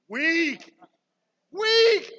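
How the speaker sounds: high, loud teasing jeer; mock disappointed. Real, not acted. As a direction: rib the crowd for being weak. Mocking the crowd's weak shout.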